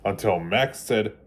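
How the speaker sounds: storybook read-aloud, gentle and lively; children's storybook narration. A narrator's reading. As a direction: read it like bedtime story time for kids. Reading picture book to children.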